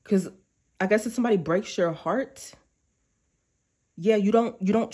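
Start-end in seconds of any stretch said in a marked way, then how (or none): none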